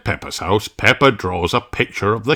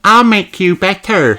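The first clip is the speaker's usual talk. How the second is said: put-on higher, louder child voice; little nurse sheep; 11 semitones higher, 6 dB louder than usual. Susie promising to heal a friend, higher child voice. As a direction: play the caring little nurse.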